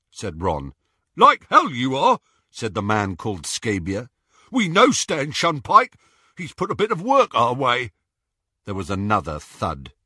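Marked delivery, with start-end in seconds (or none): 1.2-7.9 high, loud mocking thug; sneering Scabior. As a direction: jeer at the lie.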